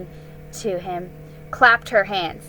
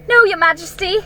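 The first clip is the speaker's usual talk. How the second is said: put-on high, loud boyish reply; a boy quick to answer; eager boy; 10 semitones higher, 9 dB louder, faster than usual. Edmund answers.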